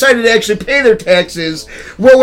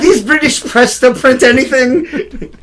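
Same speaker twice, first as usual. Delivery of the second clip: put-on Glasgow accent; 4 semitones higher than usual. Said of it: "she sounded like she was from Glasgow".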